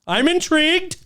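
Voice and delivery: high, loud show of interest; hammed-up, high-pitched intrigue; mock intrigue. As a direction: pipe up with exaggerated fascination. Exaggerated interest in the story.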